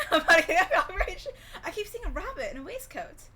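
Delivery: slow and loud, laughing through the line; can't get through it for giggling; real laughter breaking up a retold line. Real, not acted. Repeats the quote while cracking up.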